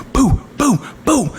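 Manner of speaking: high, popping mock punch noises; imitated knockout hits; punch sound effects. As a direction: voice each blow as a falling "boo".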